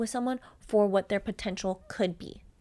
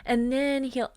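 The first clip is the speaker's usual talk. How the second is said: mock chill girl; 4 semitones higher than usual.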